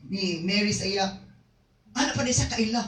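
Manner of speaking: ordinary talk, nothing marked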